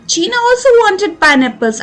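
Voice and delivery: slow, deliberate reading aloud for pupils; slow story reading. A narrator's reading. Teacher reading the story line to students, slow and clear. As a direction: read each word out clearly and slowly.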